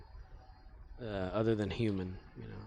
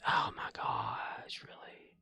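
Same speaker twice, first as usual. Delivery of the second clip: whisper; 7 dB quieter, much slower than usual. Real, not acted. Gamer reacting to game task.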